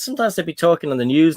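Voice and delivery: ordinary talk, nothing marked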